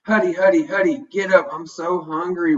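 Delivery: whining hungry dog. A narrator's reading. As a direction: whine like a hungry pup begging for breakfast. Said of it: "whines Tucks".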